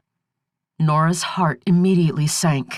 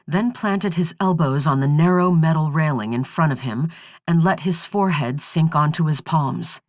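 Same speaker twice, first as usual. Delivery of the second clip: usual speaking voice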